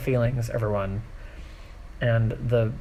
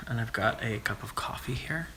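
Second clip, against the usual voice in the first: softer whispered aside; 9 dB quieter than usual. Real, not acted. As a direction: whisper it like a secret. Quiet aside about her coffee.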